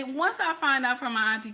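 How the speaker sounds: ordinary talk, nothing marked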